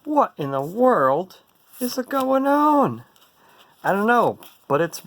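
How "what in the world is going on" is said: higher, louder, slow; real surprise. Real, not acted. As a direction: gasp it out in disbelief. Surprised at both decks amassing.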